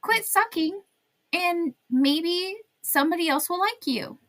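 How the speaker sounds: high-pitched teasing advice; mock scolding. Mock advice addressed to Trump.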